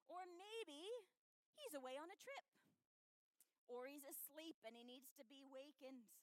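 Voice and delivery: high, loud sarcastic taunt; mocking. A narrator's reading. Elijah mocking the prophets whose god never answers, high sarcastic jibe. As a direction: rib them with exaggerated sympathy. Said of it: "Elijah began mocking them".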